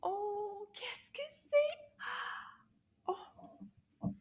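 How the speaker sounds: high gasping surprise; mock gasp. Teacher revealing the bag's secret, gasping in mock surprise. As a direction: gasp as if amazed.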